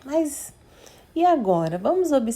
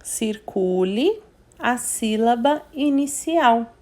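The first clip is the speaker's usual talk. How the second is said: reading the instruction slowly and clearly; teacher reads the exercise instruction slowly; slow reading aloud; slower than usual. Shown as a demonstration. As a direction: read the task line out slowly for the kids. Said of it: "que fala assim".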